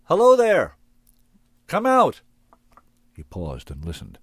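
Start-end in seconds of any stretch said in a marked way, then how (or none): 0.0-2.2 high, loud call across the clearing; loud, high hail into the empty woods; shouting out. A narrator's reading. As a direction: call out loud to whoever hides there. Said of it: "stepped into the center of the clearing and shouted".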